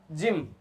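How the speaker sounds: जिम opening on a J sound; soft G. Shown as a demonstration. Teacher modelling G before Y, जिम with a J sound. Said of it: "क्या हुआ बेटा?".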